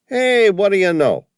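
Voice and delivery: high, loud broad East Coast cabbie voice. A narrator's reading. Cabbie calling to Sam from the taxi, broad East Coast accent. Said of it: "his fake accent".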